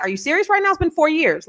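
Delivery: exasperated, high-pitched comeback in a retelling; retold incredulous reply. Host retells her reply to people shunning the singer, incredulous.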